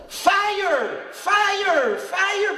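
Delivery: loud high alarm cry of fire; shouting fire. Retold or imitated. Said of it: "he starts screaming".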